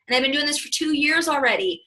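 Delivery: ordinary talk, nothing marked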